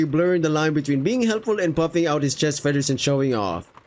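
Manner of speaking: ordinary talk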